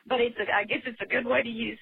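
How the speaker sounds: fast, words spilling out through laughter; laughing. Real, not acted. Caller still laughing as she sums up her project. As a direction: rush it while still giggling.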